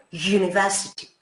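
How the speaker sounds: "university" begun with a y-glide, like "yu"; initial y sound. Shown as a demonstration. Teacher showing students that it starts with a semiconsonant, "university" with initial y sound. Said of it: "como una J y una U".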